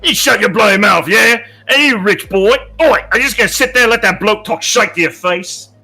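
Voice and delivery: angry. Acted. Character picks a quarrel.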